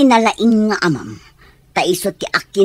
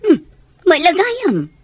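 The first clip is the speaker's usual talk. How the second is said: upset protest; 6 semitones higher, 4 dB louder than usual. Acted. Mother refuses, upset.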